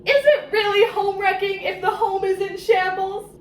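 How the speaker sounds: hammed-up self-justifying whine; mock whiny rationalizing. Mocking Lowen's excuses in a mock crying whine. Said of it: "trying to ... to think".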